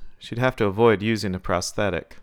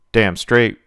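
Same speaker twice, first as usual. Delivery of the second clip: uttered firmly; 10 dB louder than usual. A narrator's reading. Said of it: "Virgil uttered".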